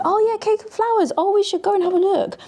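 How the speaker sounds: high-pitched delighted exclamation; excited discovery. Real, not acted. Vlogger spots a favourite cake and flower shop, excited. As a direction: exclaim it high, thrilled at the find.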